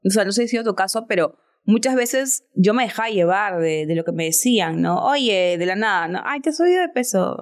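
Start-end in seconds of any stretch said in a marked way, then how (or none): 6.2-7.4 voz más aguda de conocida entrometida; comentario ajeno imitado. Retold or imitated. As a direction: imita a la amiga que opina de tu peso. Otros comentan su peso. (imitación aguda de una conocida entrometida)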